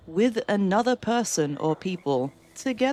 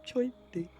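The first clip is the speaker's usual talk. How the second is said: higher, mock-shy delivery; imitated shyness; 7 semitones higher, 4 dB quieter than usual. Mimicking the shy recorded voice, shyly. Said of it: "He sounds so shy when he says that".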